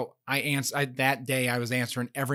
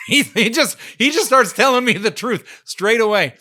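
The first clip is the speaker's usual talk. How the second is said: louder, higher, laughing through it; 8 semitones higher, 9 dB louder than usual. Real, not acted. Telling the host the scammer confessed, laughing loud. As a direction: tell it while cracking up.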